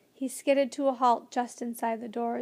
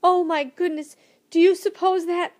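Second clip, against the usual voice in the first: louder, higher, breathless alarm; trembling alarm; 6 semitones higher, 9 dB louder than usual. A narrator's reading. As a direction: blurt it out in rising panic, cut off. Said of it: "began to tremble".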